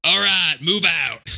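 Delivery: hammed-up Southern movie accent; Aldo. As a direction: call out an order with a put-on Southern drawl. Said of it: "an Eldo accent from Inglourious Basterds".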